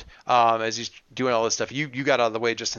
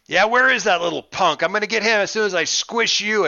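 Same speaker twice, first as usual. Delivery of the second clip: villain threat; 7 semitones higher, 4 dB louder than usual. Acted. Villain threatens before squishing.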